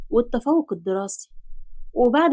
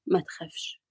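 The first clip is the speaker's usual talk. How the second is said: muttered low to himself, much softer; 6 semitones lower, 8 dB quieter than usual. A narrator's reading. Father mocking quietly to himself, muttered under his breath. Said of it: "كلم نفسه".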